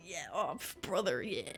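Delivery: a soft, low, half-hearted echo of the bro cheer; quiet mock bro. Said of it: "And she's like".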